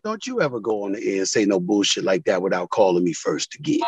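low quiet threat from a player; retold stern warning. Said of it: "He said".

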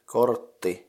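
"kortti" split into kort and ti, double t held; syllable by syllable. Shown as a demonstration. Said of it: "the syllables in it are kort and".

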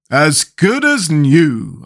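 slow, pleased and happy; joyfully. A narrator's reading. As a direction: say it with simple joy. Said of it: "answered Jack joyfully".

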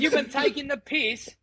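high-pitched mock Cockney; Cockney accent. Shown as a demonstration. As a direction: put on a jokey Cockney accent. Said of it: "like, the Cockney accent".

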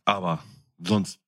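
normal talking voice

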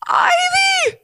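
very high, loud strained cry; shocked mom's squeezed shout; shouted stage whisper. A narrator's reading. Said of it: "her mom shouted in a whisper".

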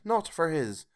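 wife mocking Scrooge's happiness; sarcastic toast. A narrator's reading. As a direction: toast him with heavy sarcasm. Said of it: "said Missus Cratchit".

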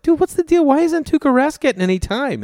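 high, loud, incredulous question; excited. Retold or imitated. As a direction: pitch way up in disbelief. Confronting Burr about hockey.

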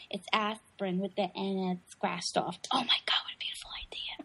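hushed, voiceless movie quote; whispered aside. Retold or imitated. Acting out a film secret for a friend, whispered. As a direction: whisper it like a secret between conspirators.

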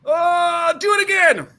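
mock agony, still eager; pained groan.

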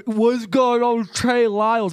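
imitating numb slurred speech; forcing words past a numb tongue; struggling numb-mouthed question. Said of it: "it was such a struggle".

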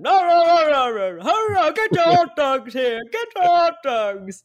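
high-pitched stadium vendor call; hot dog barker. Retold or imitated. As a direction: hawk hot dogs over a crowd's noise. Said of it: "a hot dog barker at, like, a sports game".

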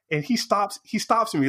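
ordinary talk

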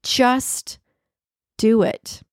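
"Just" and "do it" split by a pause; slow, spaced "Just ... do it"; slow slogan. Shown as a demonstration. Repeating the Nike motto for listeners, slowly spaced. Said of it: "that's their motto".

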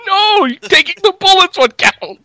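high, slow, drawn-out protest; mock indignant retort. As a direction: correct him with acted grievance. Acting out the wounded man's retort.

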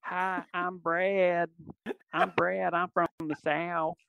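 playful put-on Southern accent; mock Southern accent. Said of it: "she'll imitate me".

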